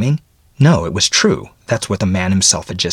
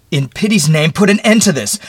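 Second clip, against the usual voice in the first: high, loud desperate outburst; anguished shout, pitch way up; bursting forth; 9 semitones higher, 6 dB louder than usual. Acted. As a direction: explode into a frantic plea. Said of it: "Then he burst forth".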